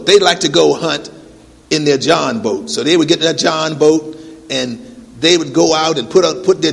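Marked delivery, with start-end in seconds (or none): none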